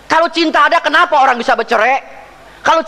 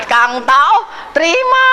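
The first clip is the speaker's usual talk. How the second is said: playful greeting; 7 semitones higher, slower than usual. Retold or imitated. Preacher playfully imitating a guest offering red envelopes, squeaky high. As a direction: toss out terima like an eager aunt. Acted greeting, laughter around.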